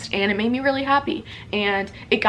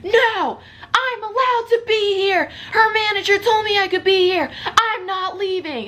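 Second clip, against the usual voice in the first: stubborn Ted; 10 semitones higher than usual. Retold or imitated. Ted refusing the neighbors, higher whiny stubborn insistence. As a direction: refuse to budge like a petulant kid. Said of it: "Ted is like".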